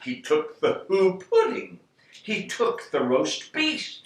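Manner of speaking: ordinary delivery, nothing marked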